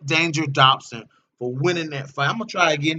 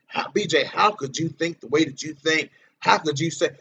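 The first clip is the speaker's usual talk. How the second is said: normal talking voice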